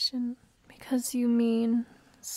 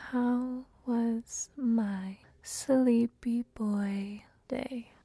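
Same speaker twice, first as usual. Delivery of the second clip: drawn out slowly in a breathy whisper; slow whispered repeat; much slower than usual. Acted. Mommy repeating the question to her sleepyhead, slow whisper. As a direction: stretch every word, whispering. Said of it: "I said".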